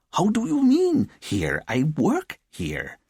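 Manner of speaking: high-pitched, incredulous outburst; indignant. Acted. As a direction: throw the question back, indignant. Boss challenged by startled officer.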